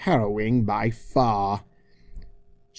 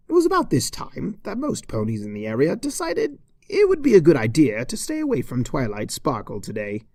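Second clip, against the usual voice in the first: ordinary delivery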